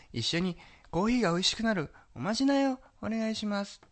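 sugary maid voice. Retold or imitated. Quoting maid's request.

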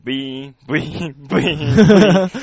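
a low, mechanical buzzing hum; machine whir. Retold or imitated. Imitating the payout machine rejecting tickets, low mechanical whir. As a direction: mimic a ticket machine feeding slips.

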